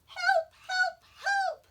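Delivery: high, loud shrieking help; cries for help. Acted. Baby sister in the dragon's cave, shrieking high and loud for rescue. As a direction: yell it from far inside the cave. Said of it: "seventh sister's cries were louder".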